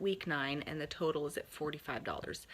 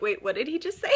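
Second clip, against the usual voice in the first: higher, louder incredulous question; stunned disbelief; 7 semitones higher, 7 dB louder, faster than usual. Retold or imitated. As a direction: react as if you can't believe your ears, voice shooting up. Acting out friends' stunned reaction.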